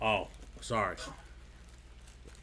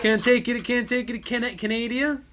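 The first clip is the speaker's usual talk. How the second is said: high, goading Canadian voice; mock Canadian; 10 semitones higher than usual. Taunting someone who can't take Canada, mock Canadian accent. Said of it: "Canadian accents".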